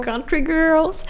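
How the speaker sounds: put-on high, drawn-out country girls; hammed-up country girls. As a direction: stretch it out, playful and high. Exaggerated repeat of phrase.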